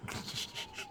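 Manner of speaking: a sudden squeal of laughter; high squeaky laugh. Real, not acted. Reader laughing at the silly fanfic text, squealing. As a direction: burst out in a thin high giggle.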